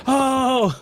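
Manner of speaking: high wailed "oh"; imitated cry of distress; mock moan. Imitating the man crying to Tito, mock moan. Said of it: "He goes".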